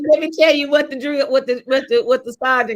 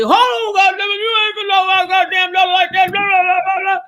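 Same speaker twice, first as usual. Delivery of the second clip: imitated angry dad; 7 semitones higher than usual. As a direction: roar at the man to protect your daughter. Dad defending daughter from sergeant.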